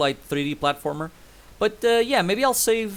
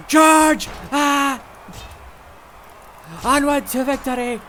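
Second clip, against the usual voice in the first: battle cry; 10 semitones higher, 6 dB louder, slower than usual. Pretend. Mock battle cry leading charge.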